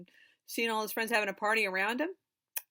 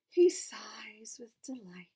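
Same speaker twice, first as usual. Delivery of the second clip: whisper. A narrator's reading. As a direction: breathe it like a contented sigh. Whispered story line.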